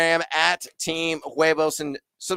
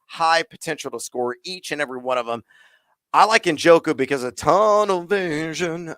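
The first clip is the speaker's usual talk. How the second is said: usual speaking voice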